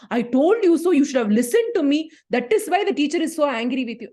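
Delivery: loud, high scolding; shouting parent. Retold or imitated. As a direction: snap I told you so at the child. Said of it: "you are not going to shout at the child".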